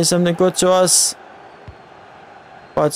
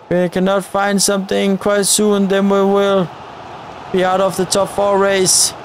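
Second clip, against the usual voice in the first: normal talking voice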